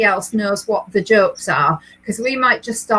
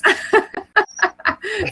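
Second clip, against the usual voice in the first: laughter; 9 semitones higher than usual. Real, not acted. Laughing at Dawn's comment.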